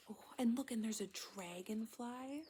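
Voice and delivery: usual speaking voice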